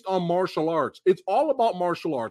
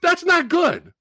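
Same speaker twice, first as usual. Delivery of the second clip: high, loud, punchy declaration; emphatic exclamation; 7 semitones higher, 5 dB louder than usual. Pretend. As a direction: call it out emphatically. Hyped reaction to the movie.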